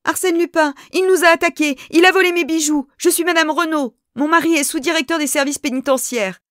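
high, loud, panting cry of a robbed lady; breathless outcry. A narrator's reading. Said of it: "Haletante, la dame s'écria".

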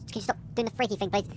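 soft, hurried plea in the dark; hushed plea. Real, not acted. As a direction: beg under your breath. Pleading quietly with creepy figure.

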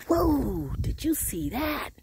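gasp, then high drawn-out amazement; startled amazement. Real, not acted. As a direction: react wide-eyed to a sudden sight. Startled by a jumping frog.

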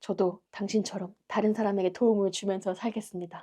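soft, earnest closing address; quiet mock-solemn thanks; solemn tribute. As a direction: speak a hushed dedication to your hero.

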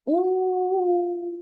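long steady train hoot "У"; train's "У" hoot. Pretend. Reciting the rhyme to the child, hooting "У" like a train. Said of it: "бо кричить він".

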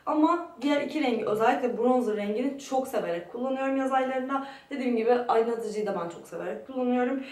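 ordinary talk, nothing marked

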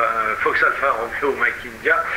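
ordinary talk, nothing marked

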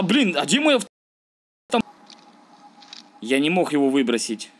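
ordinary talk

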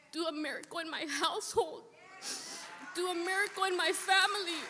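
retelling her prayer for family, rising plea; pleading prayer. Retold or imitated. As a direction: cry out the prayer for a miracle. Retold prayer pleading to God.

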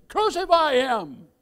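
high, drawn-out mob cry; acted crowd shout. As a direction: call it out slowly as the crowd. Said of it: "they shouted to Pontius Pilate".

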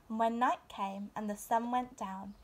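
ordinary delivery, nothing marked

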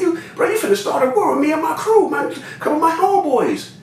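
very high, worked-up protest; retold warning. Warning supplier about starting a war, retold high-pitched indignation.

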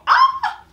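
very high, loud squeal; delighted shriek. Real, not acted. Girlfriend caught with his socks, very high loud squeal.

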